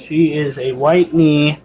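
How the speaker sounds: ordinary talk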